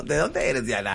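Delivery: normal talking voice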